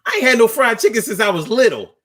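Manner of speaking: high, quick and gleeful; blurting joy at fried chicken; excited laughing quote. Retold or imitated. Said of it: "I was like".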